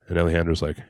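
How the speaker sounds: ordinary talk, nothing marked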